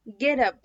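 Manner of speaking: "get up" run together as "geddup"; "get up" with T softened to a quick D; linked flap T. Shown as a demonstration. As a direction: say "get up" as one word with a tapped T. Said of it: "لا، هي get up".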